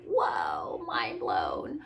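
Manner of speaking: low, drawn-out mind-blown reaction; hammed-up stunned awe; mock amazement. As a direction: play up being blown away. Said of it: "this is gonna be a shocker".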